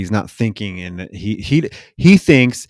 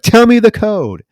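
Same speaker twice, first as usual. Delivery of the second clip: much higher, louder order; fierce command; 10 semitones higher, 6 dB louder than usual. Retold or imitated. Woman with a spike at his ear, loud fierce order. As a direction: command it, no room to refuse. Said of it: "She's like".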